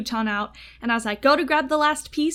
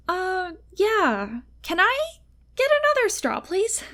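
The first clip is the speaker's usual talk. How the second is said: shy request; 6 semitones higher than usual. Retold or imitated.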